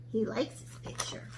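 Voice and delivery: soft whispered aside. Real, not acted.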